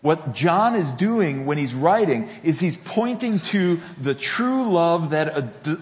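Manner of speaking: ordinary talk, nothing marked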